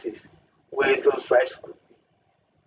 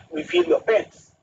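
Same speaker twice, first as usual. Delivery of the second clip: a mocking government line, pitched higher; mocking quote; 12 semitones higher than usual. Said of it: "when they say".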